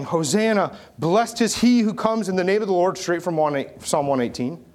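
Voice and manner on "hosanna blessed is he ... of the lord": raised, high crowd acclamation; lightly acted cry from the crowd; shouting out. Preacher voices the crowd hailing their king, shouting out. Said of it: "they're shouting out in mass".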